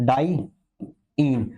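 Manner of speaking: "Diyne" very slowly in two parts, "डाई", pause, "इन"; slow, split word. Shown as a demonstration. Teacher spelling out the ending "diyne", slowly split in two. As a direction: break "diyne" after "डाई" and draw it out.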